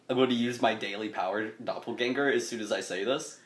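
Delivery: normal talking voice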